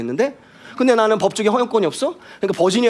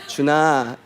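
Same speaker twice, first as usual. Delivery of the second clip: loving call; 3 semitones lower than usual. Shown as a demonstration. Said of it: "is already welcoming and loving".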